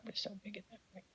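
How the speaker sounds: breathy proud whisper; whispered aside. Real, not acted. Owner bragging about his dog in a whisper. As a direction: drop to a whisper, beaming.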